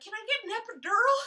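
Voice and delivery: high, loud begging plea; desperate plea. Retold or imitated. Mom in labor begging the nurses for an epidural, high and loud.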